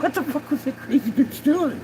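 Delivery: high, stunned, half-laughing disbelief; incredulous outburst. Retold or imitated. Retelling the mom's attempt, high disbelieving outburst. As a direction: blurt it out like you can't believe her. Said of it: "I'm like".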